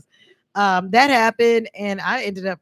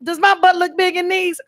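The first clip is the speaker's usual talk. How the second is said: high-pitched, saucy audition line; sassy; 10 semitones higher than usual. Retold or imitated. Actress re-enacting her winning commercial audition, sassy. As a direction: lay the sauce on the question. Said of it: "I put that sauce on it".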